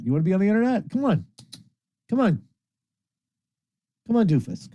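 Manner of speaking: high-pitched playful urging; coaxing a pet. Real, not acted. Host urges pet onto the internet, coaxing.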